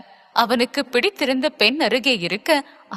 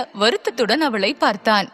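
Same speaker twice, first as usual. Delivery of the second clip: plain reading voice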